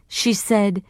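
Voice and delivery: ordinary delivery, nothing marked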